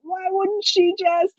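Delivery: high, slow incredulous question; exaggerated high-pitched disbelief; mock disbelief. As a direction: sound baffled at the character. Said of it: "when people are like".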